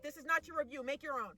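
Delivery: normal talking voice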